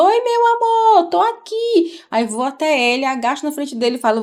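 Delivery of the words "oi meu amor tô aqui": high, slow and gentle "Oi, meu amor"; mother retelling her gentle answer to her son, high and slow; tender reply. Retold or imitated. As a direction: answer him lovingly, drawn out. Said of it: "Eu respondo".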